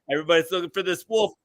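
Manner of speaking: ordinary delivery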